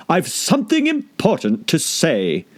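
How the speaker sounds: lofty and deliberate; caterpillar voice. A narrator's reading. Caterpillar calling Alice back, slow and lofty.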